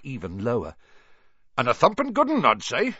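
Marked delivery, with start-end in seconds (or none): 1.6-2.9 hearty giant's voice. A narrator's reading. Hagrid hearty.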